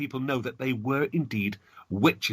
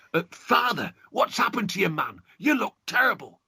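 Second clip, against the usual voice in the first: shocked son; 7 semitones higher than usual. Acted.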